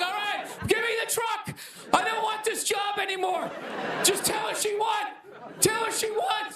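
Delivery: high, shouted panicked pleading; panicked surrender. Retold or imitated. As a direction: beg to quit, voice way up. Imagined terrified self after shooting.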